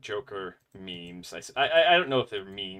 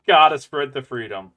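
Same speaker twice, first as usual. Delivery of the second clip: louder patriotic cheer; 3 semitones higher, 14 dB louder than usual. Shown as a demonstration. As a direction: belt it out like a proud patriot. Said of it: "repeats it louder".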